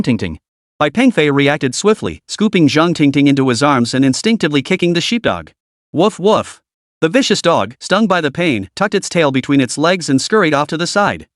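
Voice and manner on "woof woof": a yelping dog voice, changed tone; dog bark, different timbre. Acted. Kicked dog barks again.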